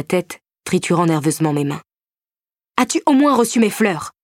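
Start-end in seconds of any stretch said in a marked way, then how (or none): 2.8-4.1 higher, bitter question; raging lover asking about the flowers; in a rage. A narrator's reading. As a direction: still seething. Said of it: "toujours en rage".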